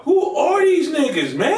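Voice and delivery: stunned, asking who they are; amazed. Real, not acted. Stunned reaction to the video.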